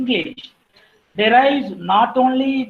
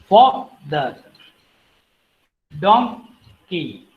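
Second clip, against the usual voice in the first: father, donkey, manage: each split in two, first syllable stressed, second drawn out and falling; word-stress demonstration; 2 semitones lower, much slower than usual. Shown as a demonstration. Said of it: "some example for the word stress".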